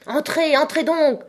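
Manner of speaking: high-pitched, raging command; impatient shout. A narrator's reading. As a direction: cry it out, burning with impatience. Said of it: "cria-t-il en les injuriant pour leur lenteur".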